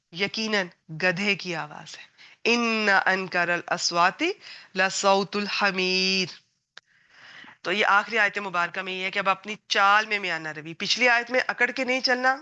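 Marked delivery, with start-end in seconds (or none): 2.4-6.3 Quran recitation. Acted. Quoting the Quranic verse in Arabic.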